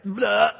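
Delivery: a quick imitated vomit noise; imitating his wife throwing up on the boat, a quick retching blurt; mock retching.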